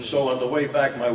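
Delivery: normal talking voice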